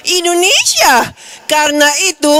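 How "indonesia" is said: higher and slow, drawn out; proud answer. Acted. Pastor gives the proud answer Indonesians should say abroad. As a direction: answer with pride, stretching it.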